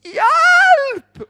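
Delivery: desperate high loud cry; shout for help. Retold or imitated. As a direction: call out through the door again. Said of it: "roper jeg en gang til".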